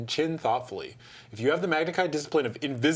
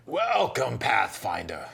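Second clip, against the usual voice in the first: put-on Eruin accent; much slower than usual. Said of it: "his thick Eruin accent echoing".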